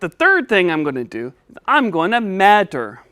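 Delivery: "measure" pronounced "matter"; lisping puppet. Pretend. Puppet giving third step, "measure" said as "madder". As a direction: turn the middle of "measure" into a D or T. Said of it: "help you say your S".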